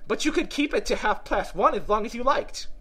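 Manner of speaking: high, animated Hatter voice; chatty, know-it-all Hatter. Acted. Hatter explaining to Alice how time obliges, eager high voice. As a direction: explain eagerly, pitched well up.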